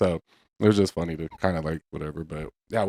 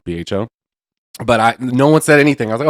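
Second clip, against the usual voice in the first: usual speaking voice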